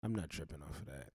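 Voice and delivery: mock easygoing Sally Mae; lender voiced as a friend. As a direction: play the loan company as a chill buddy letting you off. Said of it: "Sally Mae come to your door personally and say".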